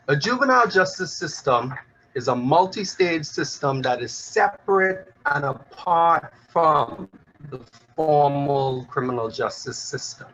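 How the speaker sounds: ordinary talk, nothing marked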